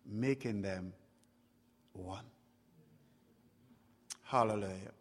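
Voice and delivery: normal talking voice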